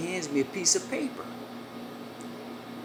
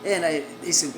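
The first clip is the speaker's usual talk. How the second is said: usual speaking voice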